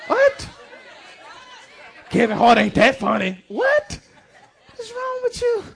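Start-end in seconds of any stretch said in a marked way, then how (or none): 0.1-0.5 high squeaky what?; playful high-pitched disbelief; mock surprise. Mock-incredulous reaction to laughter. 3.5-5.6 high squeaky what?; playful high-pitched disbelief; mock surprise. Mock-incredulous reaction to laughter.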